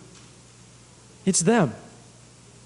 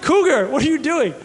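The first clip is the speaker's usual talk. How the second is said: a bit higher, amused and laughing; laughing at the dog; 7 semitones higher than usual. Retold or imitated. Boy laughing as he catches his dog sneaking in, amused. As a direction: chuckle through it at the sneaking dog. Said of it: "I'm just like".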